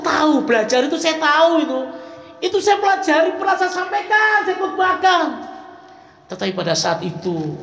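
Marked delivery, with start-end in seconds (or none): 2.4-5.3 higher, building insistence; rising emphasis. Real, not acted. Preacher insisting to the congregation that he taught this, high and insistent. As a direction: stack each phrase higher.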